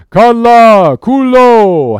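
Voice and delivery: long, high, very loud howl; Queequeg's howl. A narrator's reading. Harpooner answering the crew's wild screams, drawn-out howl. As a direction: howl each word out. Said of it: "howled Queequeg".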